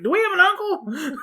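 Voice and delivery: lightly voiced puzzled boy; mock child voice, giggling; little kid asking. Imitating young Miles, laughing.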